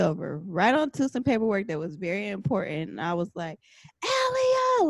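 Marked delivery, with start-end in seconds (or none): 4.0-4.9 high yelled "Elia!"; retold scream at the child, pitched way up; screaming a name. Mom yells at daughter over spilled smoothie, high scream. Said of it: "I hardly ever scream at her like that".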